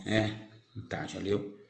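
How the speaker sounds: normal talking voice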